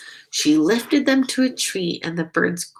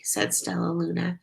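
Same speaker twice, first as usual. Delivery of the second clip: plain reading voice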